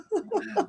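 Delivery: soft, high laugh; laughter. Real, not acted. Reader admitting names are a roadblock, soft laughing. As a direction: giggle quietly to yourself.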